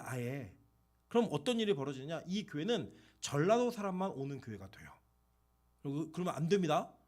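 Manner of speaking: ordinary talk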